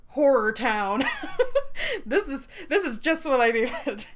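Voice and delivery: loud laughing glee; laughing delight. Real, not acted.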